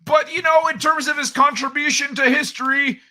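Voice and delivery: put-on high, loud old-man character voice; Old Man Kai voice. Host ribbing his co-host, as a high, loud old man.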